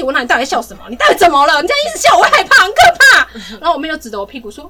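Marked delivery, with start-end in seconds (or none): 0.2-3.3 builds from plain to much higher and louder, panicky; begging a giggling sister to stop; re-enacting a scared big sister's plea; scared pleading.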